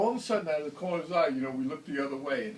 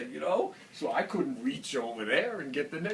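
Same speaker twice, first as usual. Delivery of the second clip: usual speaking voice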